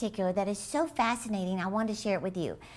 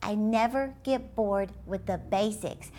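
slower, each word set down deliberately; slow emphatic repeat. Shown as a demonstration. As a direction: repeat it slowly so it sinks in. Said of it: "Let me repeat that".

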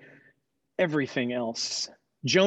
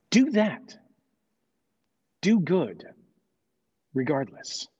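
firm command. Acted. God commands Jonah, firm and raised. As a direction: lay down the final word.

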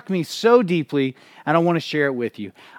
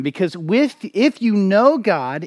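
ordinary talk, nothing marked

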